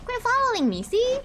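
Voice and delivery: high, squeaky bratty voice; bratty imitation. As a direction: mimic the bratty boy. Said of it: "What a brat".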